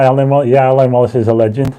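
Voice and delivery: ordinary talk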